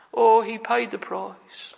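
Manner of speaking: slow, weighty preaching of the line; slow emphatic. Acted. Preacher to congregation, slow and weighty. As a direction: lean on each word, slowly.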